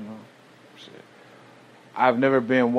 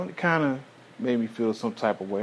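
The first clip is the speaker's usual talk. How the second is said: ordinary talk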